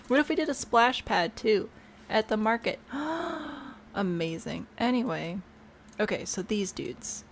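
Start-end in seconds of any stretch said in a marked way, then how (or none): 2.8-3.9 excited gasp. Real, not acted. Delighted reaction to own idea.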